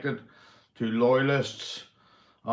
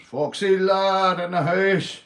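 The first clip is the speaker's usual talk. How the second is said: drawn-out, louder and higher call into the mic; loud greeting; 7 semitones higher, 7 dB louder, slower than usual. Real, not acted. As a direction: hype up the arriving viewer, stretching it out. Greeting a viewer on stream.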